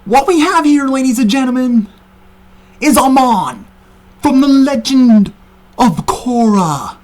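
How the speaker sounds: announcer voice. Acted. Announcer-style introduction.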